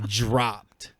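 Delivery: ordinary delivery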